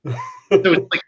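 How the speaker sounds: normal talking voice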